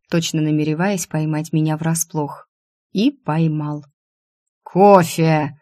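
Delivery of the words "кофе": sudden louder one-word demand; barked cry. A narrator's reading. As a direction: call it out abruptly to startle. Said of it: "вскрикнул он так, что я вздрогнула".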